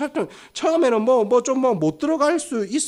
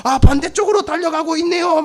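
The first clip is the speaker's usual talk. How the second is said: high, louder race call; sports commentator; 7 semitones higher, 6 dB louder than usual. Acted. Commentator sees a runner going the wrong way, mock play-by-play. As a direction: exclaim it like live play-by-play. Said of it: "중계가 시작될 거예요".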